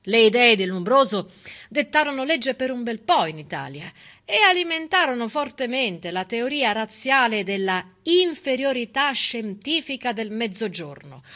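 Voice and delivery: normal talking voice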